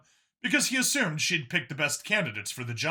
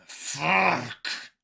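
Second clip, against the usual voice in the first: growled curse; 2 semitones higher than usual. A narrator's reading. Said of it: "he growled out".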